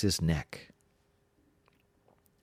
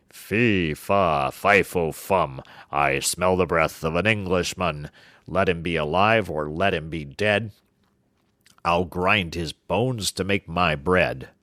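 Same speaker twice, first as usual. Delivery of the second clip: roaring giant; 3 dB louder than usual. Acted. Giant roars on entering, smelling the hidden Englishman. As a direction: bellow it like a hungry giant. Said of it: "he roared out".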